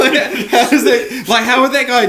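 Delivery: high laughing burst. Real, not acted. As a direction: laugh out the words. Laughing at own story.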